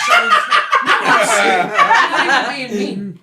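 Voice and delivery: high, loud laughing through the words; can barely get it out for laughing; giggling, protesting he's serious; laughing hard. Real, not acted. Laughing at own mean joke.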